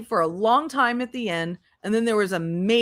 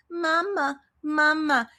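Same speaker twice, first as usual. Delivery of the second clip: put-on toddler tugging, calling; 6 semitones higher, slower than usual.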